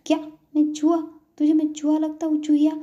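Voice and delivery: put-on high, loud childish outrage; indignant retort. Ansh answers the girl's name-calling, indignant retort. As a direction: fire back like an offended little boy. Boy indignant at being called mouse.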